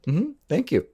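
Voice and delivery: high, chirpy thank-you; mock cheery. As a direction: say it with put-on cheer. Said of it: "is to cheerily say".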